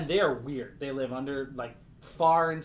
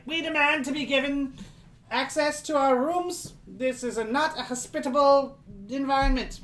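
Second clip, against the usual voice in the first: much higher, louder prim demands; haughty little creature; 11 semitones higher, 6 dB louder than usual. Acted. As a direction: insist on your rights like a tiny aristocrat. Darrow demands its rooms.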